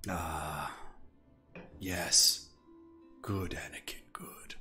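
low, hushed villain voice, drawn out between pauses; sinister mentor impression. Streamer mimicking a villain praising Anakin, low and hushed. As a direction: play a softly approving dark master, sinking to a whisper. Film villain line quoted mid-game.

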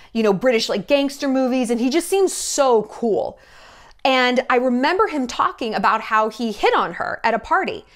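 ordinary delivery

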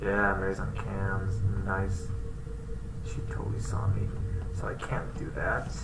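fast quiet whisper. Real, not acted. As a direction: hiss it under your breath. Caught on cams, whispered.

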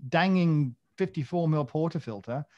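high, loud, hammed-up exasperation; exasperated questioning. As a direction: plead in mock outrage at the makers. Acts out his exasperated thoughts.

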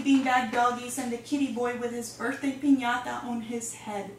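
usual speaking voice

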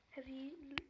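usual speaking voice

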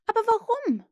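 put-on high, loud, bewildered boy; baffled protest. A narrator's reading. Peter asks Tink for her reason, baffled. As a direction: protest loudly, pitch leaping up. Peter questions the naughty fairy.

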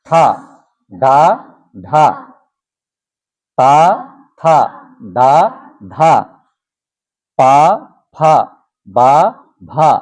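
slow, paused drill of চা, ছা, যা, ঝা through পা, ফা, বা, ভা; each consonant read alone with a clear আ, pausing between; syllables with a. Shown as a demonstration. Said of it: "একইভাবে সব জায়গাতে যোগ করে দিলে কি হচ্ছে?". (ধীরে থেমে থেমে আ-কার যোগে উচ্চারণ)

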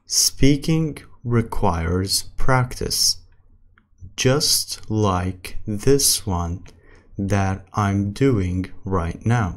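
very slow English with long pauses; slow practice reading. Shown as a demonstration. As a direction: read word by word for learners. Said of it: "السرعة الثالثة اللي هي الطبيعي".

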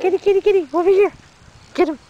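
high, loud pet call; high-pitched, urgent coaxing; calling the cat. Real, not acted.

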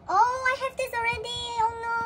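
high, loud whine of disappointment; dismayed wail. Real, not acted. Collector pulling a second duplicate, dismayed wail. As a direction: moan it high, stretching it out.

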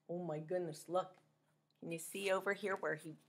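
ordinary delivery, nothing marked

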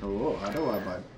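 lightly put-on Canadian accent. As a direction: say bud in a mock Canadian accent. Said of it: "with that accent".

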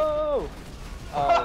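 surprised shout. Real, not acted.

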